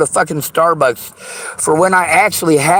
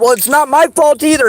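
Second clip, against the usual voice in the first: angry shout; 7 semitones higher than usual. Real, not acted. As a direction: fling the words back at them, raging. Furious rant at the listeners.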